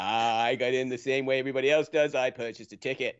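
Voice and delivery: put-on Boston-accented politician fielding press questions. Players' association director addressing reporters, Boston accent. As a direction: do a speechifying official with a Boston accent. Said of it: "you have the best Boston accent".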